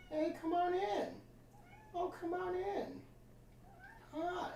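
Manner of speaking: high, soft cat-calling coo. Real, not acted. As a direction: coax the cat in gently, high and hushed. Coaxing cat into the room.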